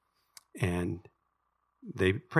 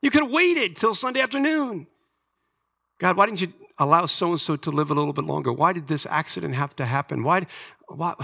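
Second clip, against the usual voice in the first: high, loud complaining cry at God; mock-furious venting at God; imitating angry prayer; 8 semitones higher, 3 dB louder than usual. Preacher acting out his frustrated prayer, high loud complaint. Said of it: "How many times I get mad and say".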